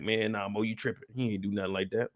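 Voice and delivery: mock doubters. Imitating viewers who doubted him, soft dismissive mumble. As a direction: wave it off like a doubtful fan.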